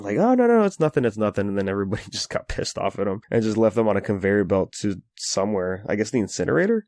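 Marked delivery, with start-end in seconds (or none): 0.2-1.5 high-pitched mock fluster, falling back to normal; hurried denial starting high, sliding down; retold freak-out. Characters caught out at a fake funeral, mock panicked. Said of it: "they're all freaking out".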